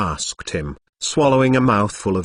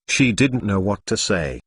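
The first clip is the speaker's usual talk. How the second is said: plain reading voice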